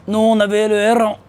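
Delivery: grandfather voice. Acted. Jealous grandfather answering his teasing grandson, put-on old-man voice. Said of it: "Il me dit comme ça".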